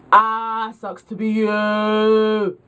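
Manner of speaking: slow, stretched-out "you" in mock pity; imitated tipsy gloating; mocking jeer. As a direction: drag it out as a drunken taunt. Tipsy friend mocking two guys.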